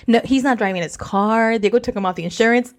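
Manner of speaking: ordinary delivery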